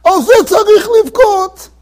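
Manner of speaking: high, wailing retold outcry; imitated bursting out crying. Old rabbi imitated bursting out crying at his colleague's words, high wail. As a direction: cry it out as the weeping old rabbi would. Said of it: "bursts out crying".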